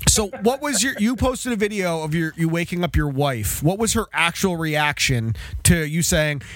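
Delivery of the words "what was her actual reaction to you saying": ordinary delivery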